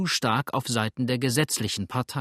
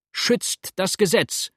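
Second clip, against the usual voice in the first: high, drawn-out loud cry; crowd shout; 8 semitones higher, 5 dB louder, slower than usual. A narrator's reading. Crowd answering from all sides, high drawn-out shout. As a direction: shout it slowly over a crowd. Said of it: "rief es aber von allen Seiten".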